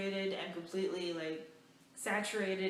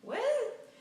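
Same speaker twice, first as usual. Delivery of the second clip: stunned, high-pitched what; shocked what; 4 dB louder than usual. Retold or imitated. Coworker just scolded her in front of a customer, shocked squeal. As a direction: react in total disbelief. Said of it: "I was like".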